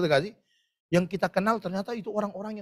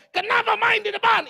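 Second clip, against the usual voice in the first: mock scolding; 12 semitones higher, 5 dB louder than usual. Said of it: "waktu kau ngomong".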